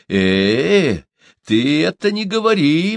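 stretched, loud drawl; a hint of a spiteful old man; drawn out. A narrator's reading. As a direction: drag out the objection. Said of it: "протянул Ямбука".

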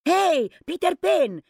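loud shout. Acted. A lookout shouting to Peter Pan to alert the pirates. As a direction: hail him from afar. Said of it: "se auzi un strigăt".

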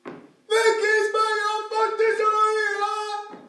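anguished cry. Acted. Man reliving the stoning, crying out in anguish. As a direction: cry it out slowly in pain.

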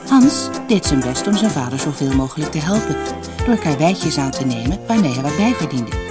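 plain reading voice

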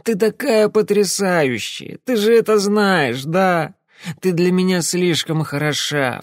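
put-on high, maudlin drunk pleading with his ex; tearful drunk fishing for reassurance; weepy drunk man.

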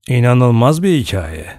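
usual speaking voice

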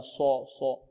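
صو with lips rounded, said soft; wrong rounded sad. Shown as a demonstration. Teacher softly showing the lip-rounding mistake. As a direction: round صو softly to show the error. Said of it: "ضم الشفتين، خطأ".

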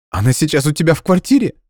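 put-on tearful girl. Hurt girlfriend demanding answers, high tearful. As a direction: press him for an answer. Nadya questioning.

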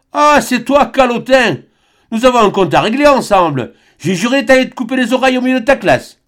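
very high, loud, fast sneering menace; angry shouted threat. A narrator's reading. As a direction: bellow at the hated friar. Jean Bernat menace le frère. (l'oncle menace le frère en criant, très aigu)

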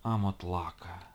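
low hissing creature voice. Acted. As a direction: hiss it out like smoke. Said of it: "прошипела Хо".

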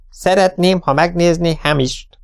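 formal lady client addressing the vet; military briskness. A narrator's reading. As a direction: state the request formally, as an order. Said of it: "közölte katonásan".

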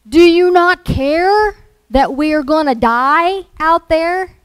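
high, loud, panicked shouting; panicky yell. Acted. Disciples waking Jesus as the boat sinks, panicky yelling. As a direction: yell urgently to rouse a sleeper. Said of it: "they were panicky and yelled".

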